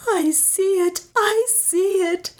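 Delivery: horrified high wail, drawn out; shrieked. A narrator's reading. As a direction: shriek in a held-back, high-pitched voice. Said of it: "shrieked Beatrice".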